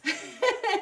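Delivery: high bright giggle; delighted laugh. Real, not acted. Laughs at dancing picture.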